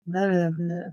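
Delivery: ordinary talk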